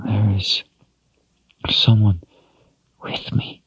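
high then fading to an unvoiced whisper; terrified hushed voice; frightened, fading to a whisper. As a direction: let the fear drain the voice away. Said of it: "A small moan escaped".

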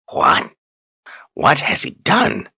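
croaked, slow alarmed question; croaking. A narrator's reading. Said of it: "Zed croaked".

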